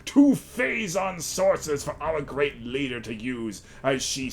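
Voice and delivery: slow, grand villain monologue voice. Acted. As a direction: read it like a gloating cult villain. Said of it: "I like reading in my villain voice".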